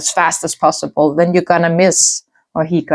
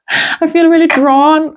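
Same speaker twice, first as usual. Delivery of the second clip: laughing at own slip; 11 semitones higher, 3 dB louder than usual. Real, not acted. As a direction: chuckle through the correction. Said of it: "I was about to say".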